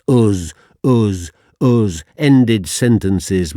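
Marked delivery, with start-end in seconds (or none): 0.1-2.0 each "us" punched loud in Yorkshire /ʊz/; defiant own voice. Real, not acted. Poet defying his teacher, loud and proud. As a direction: hammer the "us" proudly. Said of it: "used my name and own voice".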